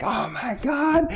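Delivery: high, slow amazed cry; crowd amazed. Acted. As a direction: draw it out high like a stunned crowd. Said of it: "it's like".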